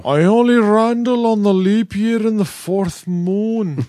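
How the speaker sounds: high, slow snobby voice; a pompous beer snob boast. Acted.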